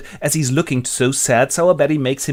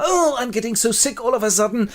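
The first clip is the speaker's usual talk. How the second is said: high, put-on female moan; mock-sickly, pitched way up; ailing Rose; 10 semitones higher than usual. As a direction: swoon in a melodramatic high voice. Rose suddenly falls ill.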